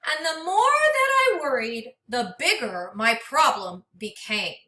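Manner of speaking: gentle, expressive storybook read-aloud; reading to children. Acted. Teacher reading a storybook to her class, expressive and gentle. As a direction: read it like a picture book for a class.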